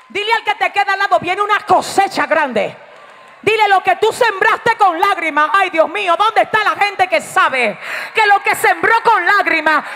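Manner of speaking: loud, high-pitched exhortation to the congregation; full-force preaching. Real, not acted. Preacher rousing the congregation about something new coming, full-force preaching.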